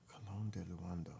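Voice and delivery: soft voiceless echo of "Colon de Luando"; whispered repeat. Real, not acted. Repeats name to herself.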